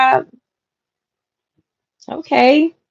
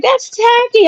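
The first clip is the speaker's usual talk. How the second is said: much higher, mock prissy put-on voice; sister's disapproval. Host mimics sister judging her full wine glass, prissy high voice. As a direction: imitate the sister looking down her nose. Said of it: "She's like".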